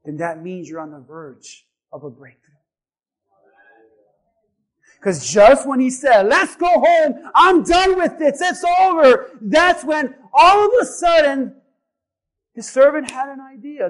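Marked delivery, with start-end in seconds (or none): 5.0-13.9 loud, high preacher's shout; full-force preaching. Acted. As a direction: build the story at a full-voiced shout.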